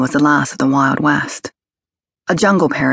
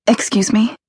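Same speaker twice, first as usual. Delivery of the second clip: put-on nervous young woman; 6 semitones higher than usual. A narrator's reading.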